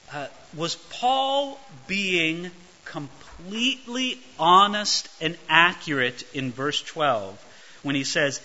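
usual speaking voice

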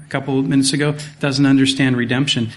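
ordinary talk

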